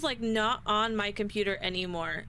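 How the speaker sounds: usual speaking voice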